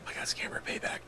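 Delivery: soft, breath-only disbelief; hushed shell-shocked murmur; stunned whisper. Acted. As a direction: whisper it to yourself, defeated.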